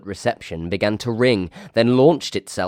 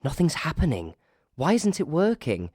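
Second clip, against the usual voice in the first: staff voice; 9 semitones higher than usual. Acted. Staff meeting, staff voice.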